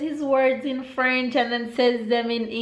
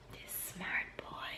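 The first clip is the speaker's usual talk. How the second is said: soft breathy whisper of praise; 19 dB quieter than usual. Real, not acted. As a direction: whisper it proudly to yourself.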